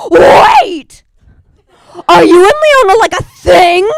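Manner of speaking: high, loud shrieking busybody; nosy friend shriek. Retold or imitated. Nosy friend loudly confronting the comic about her crush. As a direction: shout it out like a gossip catching news.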